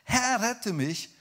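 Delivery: high, drawn-out call for rescue; acted plea, pitched high; desperate cry. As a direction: cry out loudly for help. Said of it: "schrie er".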